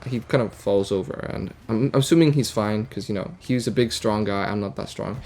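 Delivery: usual speaking voice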